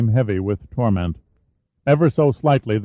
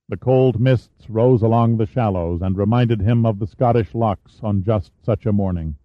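ordinary delivery

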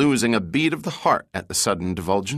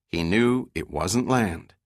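usual speaking voice

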